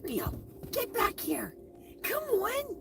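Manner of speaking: high singsong calling to the dog. Real, not acted. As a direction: coax the puppy back in a high voice.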